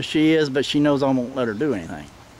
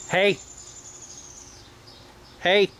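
a sharp dog correction, voice raised a little; 4 semitones higher, 3 dB quieter than usual. Real, not acted. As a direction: drill sergeant calling off the dogs. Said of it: "I raised my voice a little bit".

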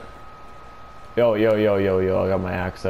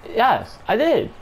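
squeaky high smug voice; high silly voice; 12 semitones higher, slower than usual. Pretend. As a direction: proudly answer in falsetto. Proud silly answer.

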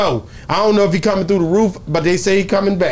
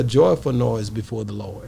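lower, softer preacher quote; mock preacher; 8 semitones lower, 6 dB quieter, slower than usual. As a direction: quote the Bible like a hushed preacher. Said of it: "Oh, preacher".

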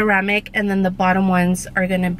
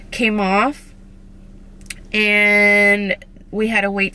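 talking around tooth brackets; speaking with new braces. Real, not acted. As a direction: finger touching front teeth. Seen 1: Points at teeth; brackets faintly visible when teeth show.

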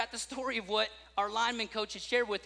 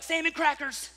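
put-on off-the-wall kid; 8 semitones higher, 5 dB louder than usual. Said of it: "when he says off-the-wall stuff".

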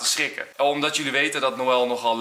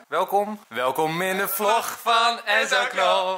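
vlog intro catchphrase; 4 semitones higher, slower than usual. Acted.

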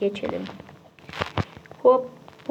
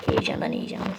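soft whispered aside; 6 dB quieter than usual. Real, not acted. Gamer whispers his own answer about sweating, hushed. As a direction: breathe the words as a private whisper.

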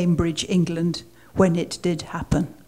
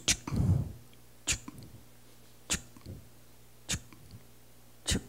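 short clipped mechanical chuks, evenly spaced; pen recorder noise; 15 dB quieter, much faster than usual. Retold or imitated. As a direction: tap out a sticking pen recorder. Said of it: "the pen recorder goes".

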